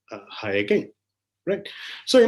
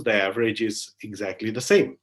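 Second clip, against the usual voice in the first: ordinary delivery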